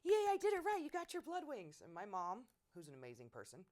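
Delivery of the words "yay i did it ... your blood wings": high, loud delighted mother cheering; gleeful mom. Retold or imitated. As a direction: cheer like a proud mother who got it right. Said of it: "And she was like".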